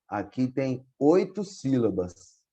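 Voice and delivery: normal talking voice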